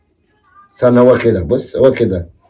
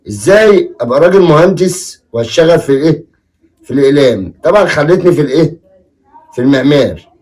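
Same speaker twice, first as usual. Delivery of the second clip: ordinary talk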